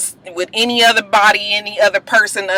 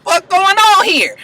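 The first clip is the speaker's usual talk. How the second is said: high-pitched mock outrage; startled confrontation; 11 semitones higher than usual. Lucius walks in on Jamal about to kiss, startled confrontation. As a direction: act out catching them, voice jumping up.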